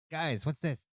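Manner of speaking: high-pitched, puzzled character voice; Stennel. Acted. Game master voicing a party member who finds something, high and questioning. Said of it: "In case Sten runs across something".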